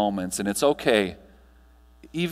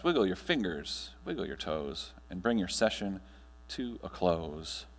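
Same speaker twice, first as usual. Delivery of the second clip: guided meditation; 3 semitones lower than usual. Acted. Speaker guiding the audience through breathing, calm meditation voice. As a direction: ease the room gently into stillness.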